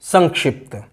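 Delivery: "संक्षिप्त" said as sank-shipt, ng before ksh; velar nasal. Shown as a demonstration. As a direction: keep the ng and full ksh in "संक्षिप्त". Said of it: "सटीक उच्चारण क्या है". ("संक्षिप्त" में ङ और क्ष की ध्वनि)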